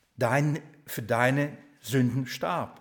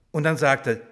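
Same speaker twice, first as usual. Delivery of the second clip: ordinary delivery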